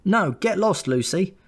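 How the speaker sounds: put-on high, whiny little boy; little brother protesting. A narrator's reading. Little brother shoos off Lucy, high and whiny. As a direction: push her away like a cross small boy. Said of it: "my little brother would say".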